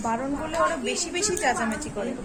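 very soft, under the breath; hushed talking. Real, not acted. Speaking near sleeping dad, hushed. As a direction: keep it down so nobody wakes. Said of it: "আস্তে আস্তে কথা বলছি".

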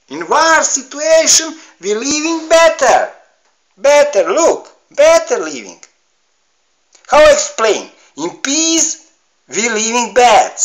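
high, loud and insistent; loud rant. Real, not acted. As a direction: hammer home that war was better. Ranting about life in war.

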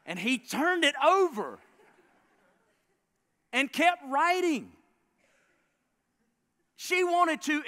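high, loud, building storyteller intensity, slowing down; climactic storytelling. A narrator's reading. Preacher telling the congregation about a furious wife, rising loud and high. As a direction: paint the wife's rage as she is about to explode. Wife's fury at husband's letter.

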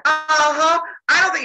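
high, loud defiant retort; acted sassy challenge; goading. She goads Zeus in quarrel.

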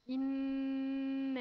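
إنا with the nun held in a long hum; إنا drawn out with a long nasal nun; long nasal ghunna. Shown as a demonstration. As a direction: stretch the doubled nun of إنا. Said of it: "لازم نغن الحرف شوية".

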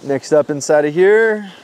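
normal talking voice

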